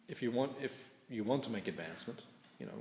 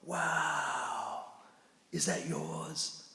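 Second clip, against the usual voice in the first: higher, slowly amazed, is that yours drawn out; admiring monk voice; 7 semitones higher, much slower than usual. Retold or imitated. Monk Navina admiring a stranger's BMW, slow and amazed. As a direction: play the impressed stranger to open the talk. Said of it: "Navina goes".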